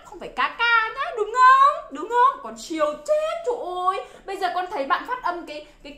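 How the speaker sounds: normal talking voice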